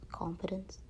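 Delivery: normal talking voice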